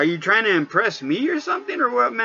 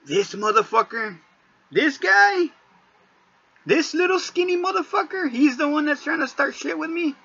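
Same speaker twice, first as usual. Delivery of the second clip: much higher, sneering disbelief; scornful disbelief at the skinny guy; mocking disbelief; 10 semitones higher than usual. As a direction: size him up with scorn, pitched high. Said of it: "and I'm like".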